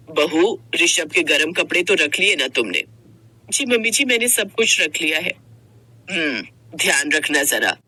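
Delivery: a caring but bossy mother-in-law. Acted. Mother-in-law voiced by narrator.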